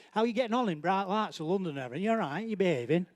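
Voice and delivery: fast, broad Lancashire chat, tongue in the side of the mouth; quick friendly Lancashire check-in; Lancashire accent. Retold or imitated. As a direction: rattle it off like a cheery Lancashire mate. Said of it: "he's taught me to do a bit of that Lancashire".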